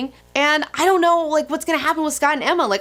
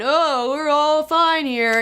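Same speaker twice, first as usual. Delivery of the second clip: higher, drawn-out singsong mockery; mock carefree; 4 semitones higher, much slower than usual. Upset fan mimicking untroubled heroes, slow mocking singsong. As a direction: stretch it out in sarcastic, airy reassurance. Mocks the unharmed other characters.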